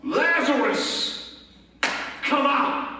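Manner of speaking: slow, drawn-out summons; loud call. Acted. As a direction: call the dead man out, word by word. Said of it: "called out in a loud voice".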